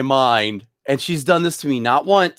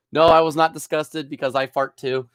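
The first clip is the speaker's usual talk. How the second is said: ordinary talk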